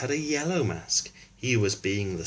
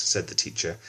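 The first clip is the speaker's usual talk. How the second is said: usual speaking voice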